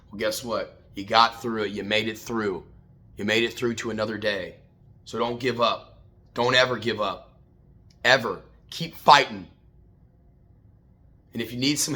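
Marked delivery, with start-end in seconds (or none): none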